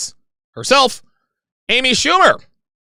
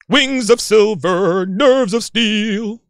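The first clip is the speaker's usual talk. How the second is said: cartoon intro; 4 semitones higher, much slower than usual. Quotes Silverhawks cartoon intro.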